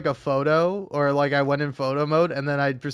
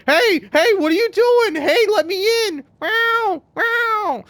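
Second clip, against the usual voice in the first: very high, loud pleading cat begging to be let in; needy cat voice; 6 dB louder, slower than usual. Retold or imitated. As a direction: squeal it up like a clingy cat meowing.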